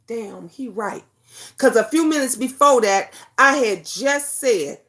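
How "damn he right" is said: soft, muttered realization; quiet admission. Retold or imitated. Mom admitting husband was right, softly. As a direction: concede it under your breath. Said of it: "I said".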